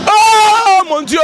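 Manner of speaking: high, loud, drawn-out oh my god; stunned commentator shout; excited cry. Real, not acted. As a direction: cry out in shock, slowly. Wrestler knocked down.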